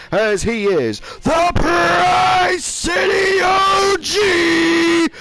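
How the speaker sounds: drawn-out intro building to a high roar; ring announcer. Acted. Announcer presenting the challenger to the crowd, ring announcer build-up. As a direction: stretch the big phrases, soaring on the fighter's name.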